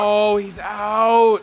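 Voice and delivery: loud. Real, not acted. Echoing the out call.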